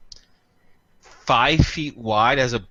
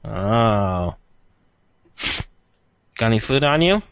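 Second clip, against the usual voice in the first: beak creature voice; 3 semitones lower than usual. Acted. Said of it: "pretending it's a beak and talking".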